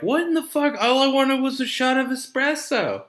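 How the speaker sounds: high-pitched frantic whine; retold freak-out in a squeaky high voice; mock panic. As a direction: wail it in exasperated panic, way up high.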